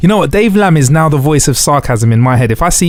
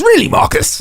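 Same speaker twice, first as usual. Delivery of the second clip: sarcastic narrator voice. Retold or imitated. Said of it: "he goes".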